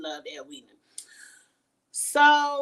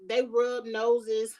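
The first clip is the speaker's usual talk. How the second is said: slow drawn-out mock; much slower than usual. Running joke on nose rubbing.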